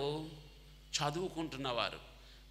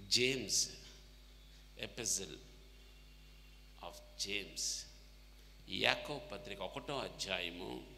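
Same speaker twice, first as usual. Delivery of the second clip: normal talking voice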